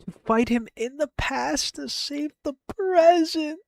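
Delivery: high, soft, drawn-up voice; overcome. Real, not acted. Streamer overcome by own true-ending idea, high soft voice. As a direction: say it high and small, rising toward the end.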